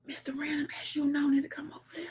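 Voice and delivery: fast breathy whisper; whispered comment read. Shown as a demonstration. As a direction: rush it out under the breath.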